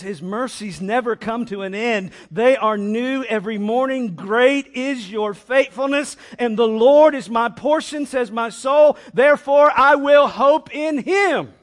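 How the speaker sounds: loud, high declaration of scripture; ringing climactic proclamation; triumphant preaching. Acted. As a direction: proclaim it at full force, building to the end.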